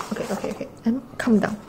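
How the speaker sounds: ordinary talk, nothing marked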